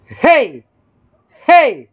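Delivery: high, sudden mic-test yell; shouting. Shown as a demonstration. Demonstrator showing the orange meter light, shouting. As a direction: belt out a short, high "hey". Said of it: "sisigaw ako, ha".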